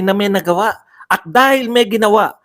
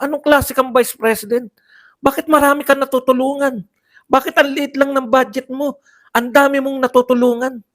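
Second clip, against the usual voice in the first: mock indignant congressman; 7 semitones higher than usual. Parodying congressmen grilling VP.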